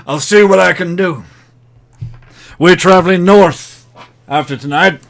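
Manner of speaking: loud, brash promoter voice; gruff fight promoter. Acted. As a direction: talk like a seedy fight promoter. GM voices ring announcer.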